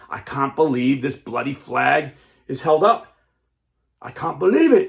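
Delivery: mad captain. Acted. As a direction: let the anger swell until it bursts. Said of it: "the captain was getting so mad".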